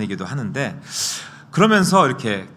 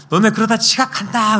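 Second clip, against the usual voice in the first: put-on high, loud scolding of late kids; scolding call; 11 semitones higher, 6 dB louder than usual. Parent calling up to sleeping kids, loud and high. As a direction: nag the kids to get up.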